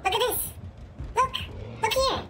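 high, urgent calling of attention; excited alarm. Real, not acted. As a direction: get your friend to look right now. Urgently pointing out transforming killer.

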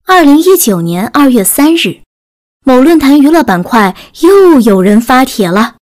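usual speaking voice

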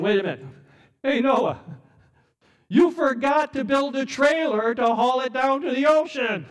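loud, high-pitched heckling; scoffing. Acted. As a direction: jeer at him like a mocking neighbor. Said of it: "They're scoffing, you know, and they're saying".

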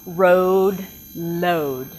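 road with a clear R, load with a clear L; minimal pair demo. Shown as a demonstration. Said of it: "I'll say some words".

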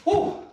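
high breathy exhale of relief; high relieved whew. Real, not acted. Relief after workout.

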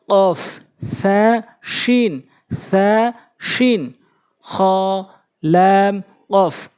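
slow, drawn-out letter names for learners; careful tajweed letter drill; Quran letter recitation. Shown as a demonstration. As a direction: pronounce each Arabic letter carefully.

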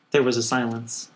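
ordinary delivery

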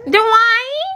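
loud, high mocking call that swings up; put-on high voice, rising sharply at the end; Whitley. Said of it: "She sound just like Whitley off of Different Worlds".

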